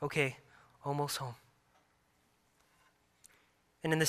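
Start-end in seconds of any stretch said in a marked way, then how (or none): 0.0-1.4 small, quiet imitation of a weepy child; understated retold whimper, very soft; mock crying voice. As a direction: softly mimic a tearful little reply. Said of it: "respond in her crying voice".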